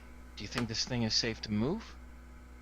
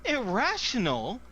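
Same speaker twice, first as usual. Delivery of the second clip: high, loud, stretched-out outraged question; outraged guard echoing the scientist's word; indignant echo; 10 semitones higher, 6 dB louder, slower than usual. Acted. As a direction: throw the word back in disbelief.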